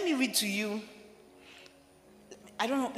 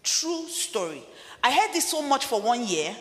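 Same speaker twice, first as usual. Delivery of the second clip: impassioned sermon voice; full-force preaching; 3 semitones higher, 3 dB louder than usual. Acted. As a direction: preach it hard to the congregation.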